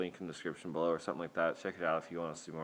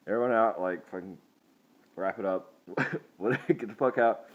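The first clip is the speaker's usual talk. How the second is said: loud, commanding clear-out yell; shouting everyone out; 3 semitones higher, 7 dB louder than usual. Retold or imitated. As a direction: bark at the customers to leave now. Clearing out the booths.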